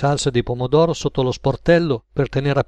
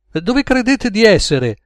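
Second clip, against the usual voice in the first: high, slow, affronted question; indignant challenge; 8 semitones higher, slower than usual. Acted. George to the two gunmen giving orders, indignant. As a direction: challenge them with raised pitch, drawn out.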